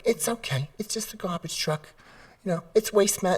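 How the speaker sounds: very high soft cooing; an owner explaining the truck in high soft baby talk; mock reassuring owner. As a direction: soothe the dog sweetly. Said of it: "we try to be nice and be reassuring".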